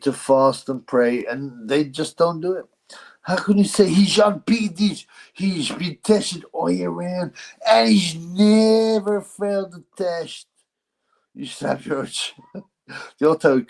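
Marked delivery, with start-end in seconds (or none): 3.2-10.5 put-on Dutch accent, indignant; Dutch accent impression. Otto impression defending himself.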